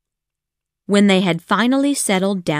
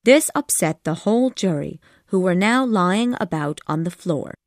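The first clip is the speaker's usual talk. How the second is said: usual speaking voice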